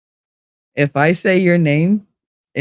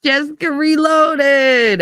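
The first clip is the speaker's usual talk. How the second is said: excited greeting; 10 semitones higher, slower than usual. Real, not acted. Greeting a chatter arriving.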